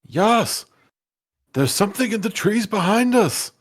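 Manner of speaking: high, loud shout across the trees; yelling. Acted. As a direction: call out urgently to a friend. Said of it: "I'm gonna yell at Yas".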